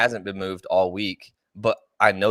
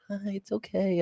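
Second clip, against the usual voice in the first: put-on soothing baby voice; 12 semitones higher, 6 dB quieter than usual. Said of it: "I'll just be like".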